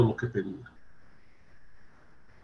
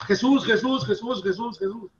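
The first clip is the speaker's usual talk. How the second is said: "Jesús" repeated in a much higher, fading mock call; mock casual calling; 7 semitones higher, 6 dB quieter than usual. Preacher mimicking believers who just call "Jesús", high mocking calls. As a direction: imitate people casually calling out "Jesús" over and over, pitched way up. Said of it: "Muchas veces oigo que dicen".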